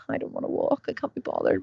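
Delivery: whispered, can't-be-bothered moan; reluctant whisper. Retold or imitated. Tired in bed before the walk, whispering reluctance. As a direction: whisper it like you're still in bed. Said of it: "I'm sitting there going".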